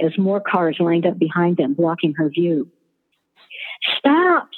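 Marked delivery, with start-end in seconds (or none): 4.0-4.5 high, louder wailing cry. Acted. Student wailing at her partner to stop. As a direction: wail it out desperately. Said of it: "she wailed".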